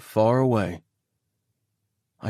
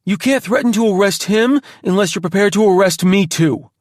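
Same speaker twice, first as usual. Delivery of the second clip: raised voice; 9 semitones higher, 5 dB louder than usual. Acted. Creed pleading angrily.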